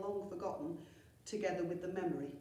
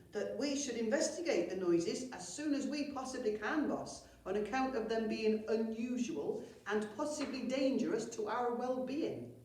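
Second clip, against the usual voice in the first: higher, louder pompous goblin voice; 5 semitones higher, 3 dB louder than usual. Acted. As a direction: sound important and self-satisfied. Snillbog pompously continuing.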